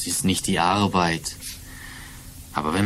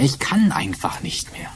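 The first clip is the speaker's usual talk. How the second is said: breaking off into a whisper; despairing whisper; 7 semitones higher than usual. Acted. Worn-out Tom admits defeat to his landlady, despairing whisper. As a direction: let the voice give out on the last words. Exhausted man giving up.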